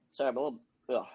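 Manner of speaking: rough, worn-out morning voice; hoarse voice. Real, not acted. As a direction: voice shot and hoarse. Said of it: "My voice is a little, a little shot".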